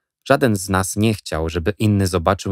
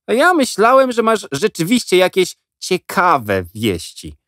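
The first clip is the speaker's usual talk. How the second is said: disappointed scoff; 11 semitones higher, 4 dB louder than usual. A narrator's reading. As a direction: let him down scornfully. Avery mocks Jack.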